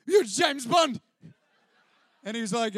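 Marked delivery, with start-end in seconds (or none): 0.1-1.0 laughing, louder, starstruck; giddy fan, laughing. Retold or imitated. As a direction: blurt it through a laugh. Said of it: "And I was like".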